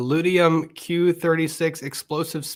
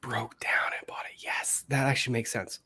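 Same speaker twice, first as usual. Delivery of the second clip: whisper; 6 semitones lower, 8 dB quieter than usual. Real, not acted. Realizing quietly, murmured aside.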